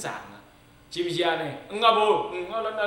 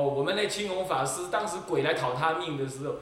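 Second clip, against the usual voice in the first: normal talking voice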